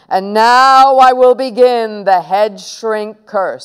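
slow, loud ceremonial voice; grand announcement. Acted. Boy starting the head shrink curse, slow and grand. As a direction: announce it slowly, like a sorcerer opening a rite.

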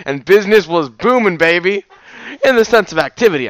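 loud, high, gleeful narration; excited. A narrator's reading. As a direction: relish it. Excited aside.

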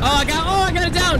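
high, loud triumphant yell; excited shout. Real, not acted. Knocking an enemy down.

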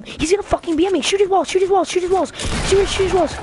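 frantic, high-pitched calls for help; screaming orders at a teammate while under fire; panicked yelling. Real, not acted. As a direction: gamer yelling at a teammate mid-fight.

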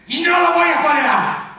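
high, loud final cry; defiant shout. Acted. Anchor closing the chant for viewers, defiant shout. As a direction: hurl it out as a last rallying yell. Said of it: "Griten conmigo".

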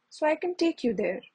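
usual speaking voice

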